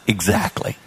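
a quiet, low knowing exactly; knowing reply. Retold or imitated. Pastor answers friend's confession, quiet knowing exactly. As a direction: drop it softly like you knew it. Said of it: "And I said".

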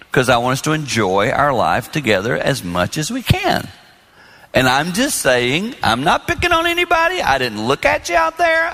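ordinary delivery

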